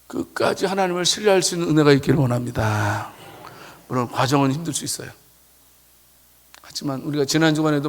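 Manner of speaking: soft, quiet encouragement to the flock; tender sermon close; gentle pastoral tone. Acted.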